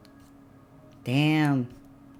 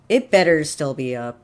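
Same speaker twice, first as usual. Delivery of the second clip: normal talking voice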